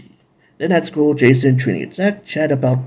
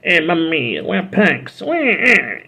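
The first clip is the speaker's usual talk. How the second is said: playful fake Italian accent, higher; Waluigi Italian accent; 4 semitones higher, slower than usual. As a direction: ham up a cartoon Italian bad guy. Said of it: "I know I'm doing Waluigi".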